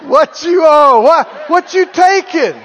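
high, loud mock amazement; hammed-up incredulous questioning; astonished envious onlooker. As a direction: demand to know the secret. Acts envious partiers questioning joyful believers.

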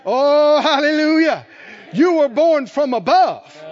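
high, loud preaching climax, slowing on born from above; preaching at full force. Acted. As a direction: lift the church up, preaching hard.

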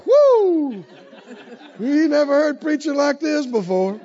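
high, loud whoop into marveling; amazed listeners. Acted. Preacher voices the crowd hearing Jesus, thrilled and amazed. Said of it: "and they thought".

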